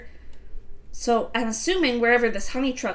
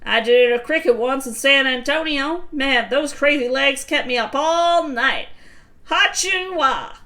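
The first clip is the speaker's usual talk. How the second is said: lightly voiced uncle; 4 semitones higher, 6 dB louder than usual. Voicing Uncle Carl.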